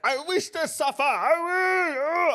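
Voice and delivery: high, strained mock-deranged voice; mocking impression. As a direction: lampoon an unhinged ranter. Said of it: "I'm not like".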